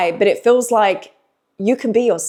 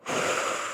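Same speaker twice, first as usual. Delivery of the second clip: long sigh; 11 dB quieter than usual. Real, not acted. Releasing emotion, then says breathe.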